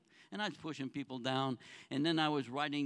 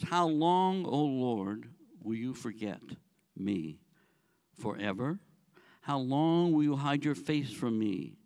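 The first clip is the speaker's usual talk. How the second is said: measured, solemn Bible reading, a little louder; scripture reading; 5 semitones lower, 4 dB louder than usual. Acted. Pastor reading Psalm to the congregation, solemn reading voice. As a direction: read the psalm out to the congregation slowly.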